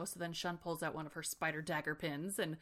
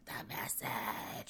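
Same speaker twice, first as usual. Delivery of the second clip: low, slow gurgling croak; gurgled message; 9 semitones lower, 4 dB quieter, much slower than usual. Acted. Dying butterfly girl gurgles at Bea, low slow croak. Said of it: "she spittles out".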